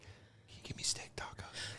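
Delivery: hushed whispered order; ordering tacos quietly among grieving players. Retold or imitated. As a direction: whisper the order so no one hears. Whispered food order at sad party.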